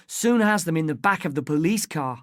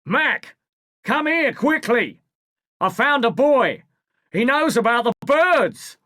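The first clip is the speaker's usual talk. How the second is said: high, loud calls to his partner; raised urgent shout; 7 semitones higher, 4 dB louder than usual. A narrator's reading. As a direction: yell urgently for Mac. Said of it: "he shouts".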